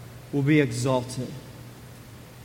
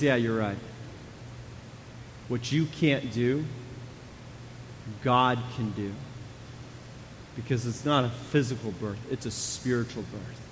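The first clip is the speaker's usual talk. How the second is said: soft, lowered, tender reply; gentle reassurance; 9 semitones lower, 12 dB quieter than usual. Acted. Preacher voicing Jesus calming Nicodemus, soft and gentle. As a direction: ease his worry quietly.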